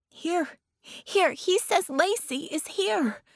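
put-on high, slow little girl insisting; high excited little girl; eager child. A narrator's reading. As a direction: point the way, breathless with eagerness. Child leading Vicky.

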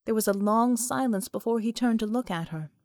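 ordinary delivery

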